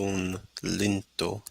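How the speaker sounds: put-on sielunlintu said slowly with an Italian accent; careful Italian-accented sielunlintu. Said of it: "in an Italian accent there".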